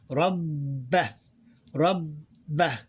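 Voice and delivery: rabba split into rab, held b, then ba; two syllables combined. Shown as a demonstration. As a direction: hold the double b in rabba before releasing it. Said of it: "two syllables combined".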